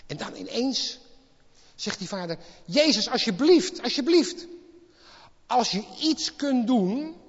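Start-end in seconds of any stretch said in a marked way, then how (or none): 2.6-4.5 desperate plea. Acted. Father begging Jesus to heal his son, loud desperate pleading. 5.5-7.2 loud high slow begging on iets; loud plea. Acted. Father begging Jesus to heal his son, loud pleading.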